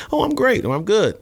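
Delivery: high, easy and upbeat; casual natural reply. Retold or imitated. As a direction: toss it off like you would with friends. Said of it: "versus I'm used to saying".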